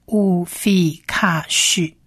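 乌菲卡许 slowly, as "u-fi-kha-sh"; 乌菲卡许 in slow, separated syllables; syllable-by-syllable demo. Shown as a demonstration. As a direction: sound out 乌菲卡许 one syllable at a time. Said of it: "所以就变成".

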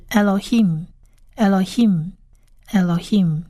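"Elohim" slowly as eh-lo-heem; model pronunciation. Shown as a demonstration. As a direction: repeat "Elohim" three times with clear gaps. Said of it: "第三个字是".

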